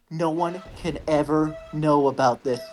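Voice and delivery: ordinary delivery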